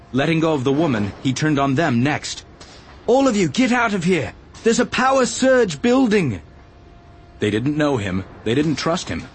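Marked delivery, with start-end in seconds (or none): 3.1-6.4 high, raised call to a crowd; urgent warning. A narrator's reading. Obi-Wan warning all the villagers of a surge, urgent call. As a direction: call out the alarm, pitched well up.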